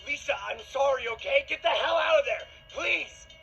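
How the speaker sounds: frantic high shout, very loud; desperate pleading. Acted. Boyfriend trying to stop what she's about to do, desperate shout. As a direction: beg at the top of your lungs. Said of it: "Desperately trying to stop her".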